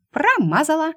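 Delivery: high mocking squeak; taunting mouse. Mouse taunts after miss.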